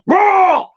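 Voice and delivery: loud imitated roar; creature roar. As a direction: roar like a beast.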